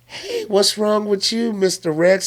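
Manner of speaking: high, slow little rabbit voice. Retold or imitated. Rabbit asking the T. rex what's wrong, high mock rabbit voice. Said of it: "and the rabbit was like".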